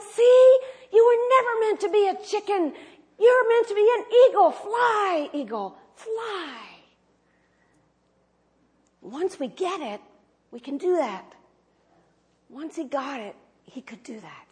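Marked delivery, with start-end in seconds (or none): 0.0-6.6 high, fast, loud cry fading on fly; triumphant shout. Retold or imitated. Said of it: "this guy shouting out". 12.5-14.5 soft, nearly whispered; hushed. Real, not acted. Reflective soft aside.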